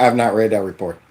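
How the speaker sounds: low, soft, dismissive mock of her answer; mocking imitation of her. Commentator mocking the official's answer, bored and dismissive. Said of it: "she talking about".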